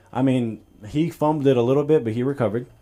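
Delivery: ordinary talk, nothing marked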